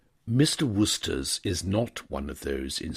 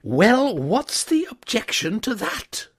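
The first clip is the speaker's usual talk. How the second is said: bitter; 7 dB louder than usual. A narrator's reading. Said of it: "with extraordinary bitterness".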